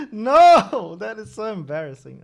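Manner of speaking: cringing laugh breaking into a yelp; embarrassed laugh. Real, not acted. Streamer caught in own counting mistake, laughing no. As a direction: laugh then a loud high cry of no.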